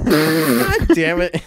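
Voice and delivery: slow exasperated groan, dragged out; drawn-out groan. Real, not acted. Annoyed podcaster groaning at the recording slip-up. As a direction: let the words sag in frustration.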